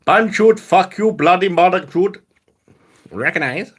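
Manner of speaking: loud, high mock Indian accent. Streamer does an Indian accent on request, loud and high. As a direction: bark it in a hammed-up Indian accent. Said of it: "doing Indian accent".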